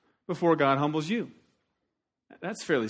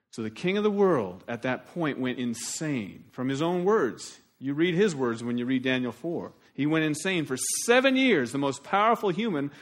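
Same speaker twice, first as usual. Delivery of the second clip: ordinary delivery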